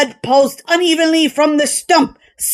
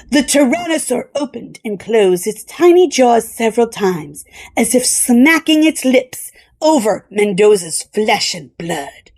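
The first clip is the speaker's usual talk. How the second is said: usual speaking voice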